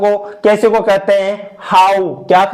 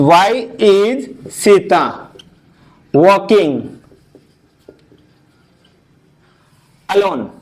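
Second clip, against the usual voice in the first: slow dictation; much slower than usual. Shown as a demonstration. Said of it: "is with singular noun".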